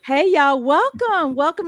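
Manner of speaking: a loud, bold hey y'all with a punch on hey; signature greeting. Shown as a demonstration. Host giving the guest his real catchphrase, loud and proud. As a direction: belt out the show greeting. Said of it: "host shows own greeting".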